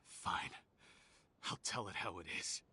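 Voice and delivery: low, breathy threat; menacing whisper. Acted. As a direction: hiss it softly at the guard. Menacing reply to guard.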